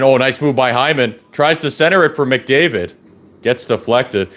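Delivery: usual speaking voice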